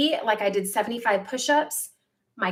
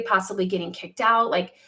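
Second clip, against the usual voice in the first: ordinary delivery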